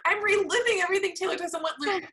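high, loud gushing; excited. Retold or imitated. Host gushing to guest about reliving her reign, excited and loud. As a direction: burst out with fan excitement.

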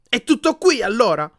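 very high, loud outburst; furious shout. A narrator's reading. Harry erupting at the silent message, furious shout. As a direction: explode at the portrait in outrage. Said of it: "urlò".

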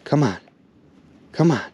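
ordinary talk, nothing marked

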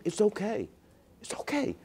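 soft, tender reassurance; soothing comfort. Acted. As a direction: hush them gently like a grieving child. God comforting the grieving.

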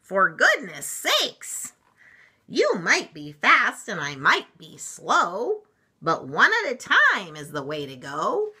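loud, slow, emphatic scolding rhyme; yelling Blue. A narrator's reading. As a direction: plead loudly with the squabbling traffic. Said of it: "yelled Blue".

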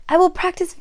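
eager determination; determined. Acted. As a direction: promise yourself loudly. Tiny determined.

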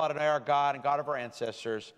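ordinary delivery

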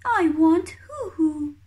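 high, whimpering chick wail; whiny crying chick. Acted. Baby kiwi begging mum for hoo hoo, high whimpering whine. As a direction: whine it like a hungry baby about to cry. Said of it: "says Hooey".

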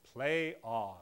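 "Play on" with both words stressed; weight "Play" and "on" equally; spondee stress. Shown as a demonstration. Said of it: "Play on is a spondy".

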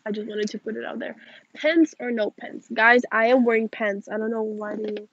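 usual speaking voice

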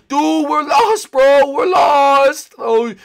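high, loud, drawn-out wail; panicked yell. Pretend. Telling bro that we're lost, panicked yell. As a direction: cry out in hammed-up alarm. Friends realise they are lost.

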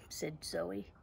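ordinary delivery

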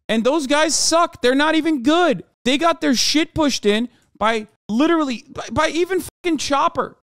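high-pitched, scornful rant; frustrated. Real, not acted. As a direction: lay into them in exasperation. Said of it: "it's deeply frustrating for me".

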